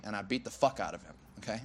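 usual speaking voice

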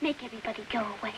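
put-on ashamed boy mumbling into his pillow; muffled mumble. [name] hiding face in pillow.

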